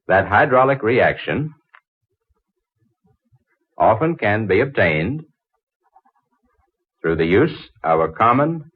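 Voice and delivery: spaced out, phrase by phrase for dictation; dictating from a book with long pauses. Acted. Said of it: "will you take this down?".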